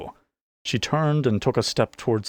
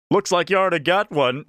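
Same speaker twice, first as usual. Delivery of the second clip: higher, louder, fast mocking call; heckler's taunt; 9 semitones higher, 4 dB louder, faster than usual. A narrator's reading. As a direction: jeer at her from across the room. Heckler taunting from tavern corner.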